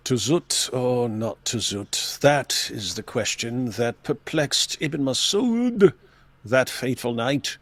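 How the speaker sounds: lightly posh British Shakespeare reading; slow, mock-grand British accent. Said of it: "Can you do a posh British accent".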